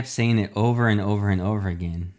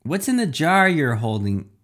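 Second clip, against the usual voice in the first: eager reporter; 6 semitones higher, 3 dB louder than usual. A narrator's reading. Reporters firing questions at hero.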